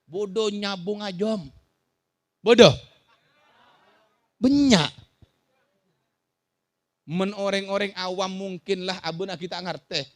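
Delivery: ordinary talk, nothing marked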